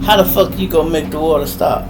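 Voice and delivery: ordinary talk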